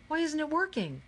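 high, frustrated question; alarmed cry. A narrator's reading. Miguel asking why the belt is dead, high baffled cry. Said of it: "cried Miguel".

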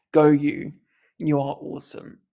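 ordinary delivery

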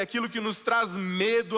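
normal talking voice

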